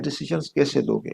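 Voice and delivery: normal talking voice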